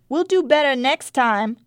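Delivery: a bright call to the teacher; called out brightly. A narrator's reading. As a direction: sing out a cheerful promise. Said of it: "Contessa called out brightly".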